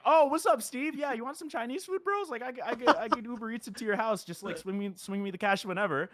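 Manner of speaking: high eager bro persona; Blowfish Man voice. Retold or imitated. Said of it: "as Blowfish Man".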